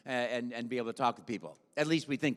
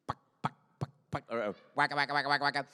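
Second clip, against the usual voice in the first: popping blips, then rapid waka-waka chomping; imitated video game noises; much faster than usual. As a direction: mimic old arcade game sounds. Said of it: "I knew nothing about video game except pong".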